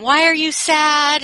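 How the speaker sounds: a gentle, childlike question, lightly voiced; little son asking. Five-year-old son asking his crying mom, lightly voiced child. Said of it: "and he was saying".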